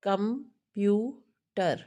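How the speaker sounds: "computer" drawn out slowly as com-pu-ter; syllable by syllable. Shown as a demonstration. Teacher showing students how to count syllables, "computer" said slowly syllable by syllable. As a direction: break "computer" into three separate beats, com, pu, ter. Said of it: "speak one word together".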